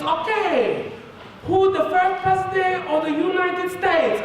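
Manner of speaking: put-on high-pitched excited Chinese-accented examiner; hammed-up Chinese accent, very high; thick Chinese accent. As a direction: announce it as a thrilled Chinese lady. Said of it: "she had a really thick Chinese accent".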